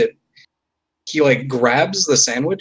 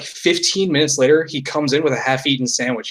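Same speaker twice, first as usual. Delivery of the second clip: ordinary talk, nothing marked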